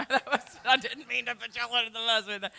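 soft, quick, chuckling aside; real chuckle while backpedalling; laughing apology. Real, not acted. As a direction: laugh through a hurried apology. Jokingly apologizes to congregation.

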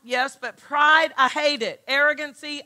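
usual speaking voice

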